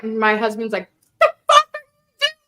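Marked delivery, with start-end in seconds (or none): 1.2-2.3 high, loud mock outraged husband; hammed-up shocked husband voice; husband freaking out. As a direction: squeal it like a panicked husband. Husband's alarmed quote, high far.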